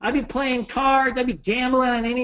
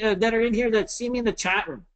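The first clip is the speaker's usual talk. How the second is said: usual speaking voice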